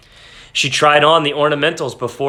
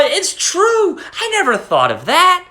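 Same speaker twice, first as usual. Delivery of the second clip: much higher, joyful exclamation; light up with sudden delight; cry of joy. A narrator's reading. Wife delighted at husband's idea, joyful cry. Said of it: "She uttered a cry of joy".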